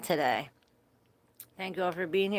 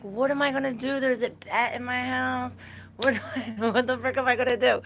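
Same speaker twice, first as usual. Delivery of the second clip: retold panic; 6 semitones higher, 3 dB louder than usual. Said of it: "I'm like".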